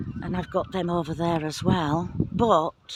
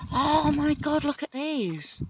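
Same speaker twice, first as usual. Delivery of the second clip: excited; 8 semitones higher than usual. Real, not acted. Discovering hidden squashes in garden.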